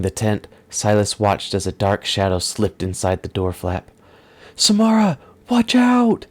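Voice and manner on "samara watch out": high, loud warning shout; high, loud desperate warning; urgent yell. Acted. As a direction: yell a warning across the camp. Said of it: "both yelled in unison".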